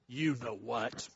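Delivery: low, soft knowing punchline; sly parrot. Acted. Parrot slyly answering the husband, low soft and smug. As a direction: drop it down, smug and quiet.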